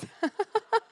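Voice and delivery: high self-conscious giggle; laugh. Real, not acted. Host straightening something on stage, giggling. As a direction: laugh at your own fussiness.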